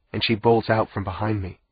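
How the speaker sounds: usual speaking voice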